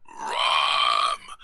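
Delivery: loud ogre shout; ogre roar. Acted. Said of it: "prennes le rôle de Shrek".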